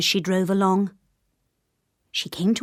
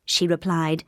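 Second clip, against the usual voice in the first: plain reading voice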